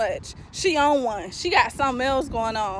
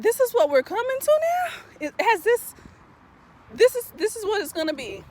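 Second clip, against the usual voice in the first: retold dismay, voice pitched higher and slowed; disbelieving quote; 9 semitones higher than usual. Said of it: "I was like".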